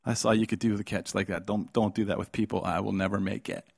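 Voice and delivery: ordinary talk, nothing marked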